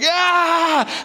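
mock furious yell; frustrated yell. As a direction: throw it all in one outburst. Throwing the furniture.

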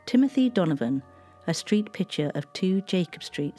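plain reading voice

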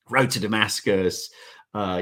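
usual speaking voice

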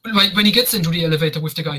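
ordinary talk

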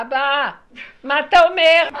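loud, high mock yell of a small child; imitated child's shout. Three-year-old on a chair challenges his father, imitated loud shout. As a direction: shout it up at Dad like an outraged toddler.

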